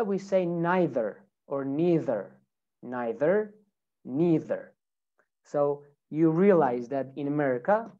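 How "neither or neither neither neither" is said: American "neither", NYE-ther or NEE-ther; American pronunciation. Shown as a demonstration. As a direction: keep the final R in "neither".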